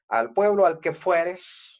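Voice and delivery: slow, emphatic reading of the saying; slow dictation. Shown as a demonstration. Teacher dictating the proverb slowly to students, emphatic. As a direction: lay out each word of "al pueblo al que fueres" slowly and clearly. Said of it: "aquí está mi primer subjuntivo".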